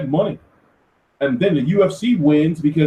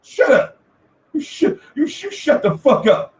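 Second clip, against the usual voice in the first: exasperated high yell; 10 semitones higher than usual. Retold or imitated. Yelling at fans who think McGregor has power, high and exasperated. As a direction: blow up at them, voice cracking high. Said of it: "I'm like".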